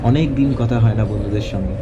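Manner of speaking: usual speaking voice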